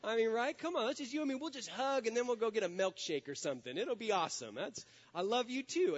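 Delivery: mock-eager child's wheedling; pleading son. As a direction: plead your way out of a spanking, pitch climbing. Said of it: "And I was like".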